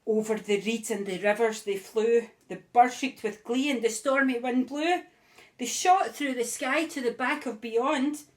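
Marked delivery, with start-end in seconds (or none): none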